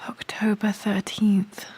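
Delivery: whisper. Real, not acted. Reading an inscription to herself.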